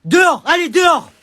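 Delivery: high, loud stern order; angry teacher. Acted. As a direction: throw him out, furious. Teacher throwing pupil out.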